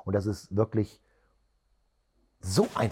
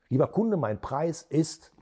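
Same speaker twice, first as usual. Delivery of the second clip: slow lead-in, stop dead after IST; pause for effect; 4 semitones higher, slower than usual. Shown as a demonstration. Trainer demonstrates naming a price to a customer, effect pause. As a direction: leave IST hanging for effect.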